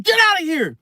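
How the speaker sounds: high-pitched reenacted yell; retold angry yell. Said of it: "after yelling at him".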